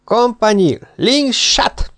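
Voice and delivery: high, barked army order from the saddle; shouted command. Acted. Red-faced officer shouting an order at the tired soldiers. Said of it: "vörös arccal kiáltotta".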